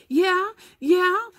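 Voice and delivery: high, loud, frantic agreement; manic. A narrator's reading. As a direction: brush it off wildly. Alan manic, scheming.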